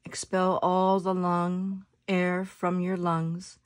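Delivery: soft, unhurried meditation voice with long pauses; guided meditation. Acted. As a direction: guide them gently into their breath, letting phrases settle softly.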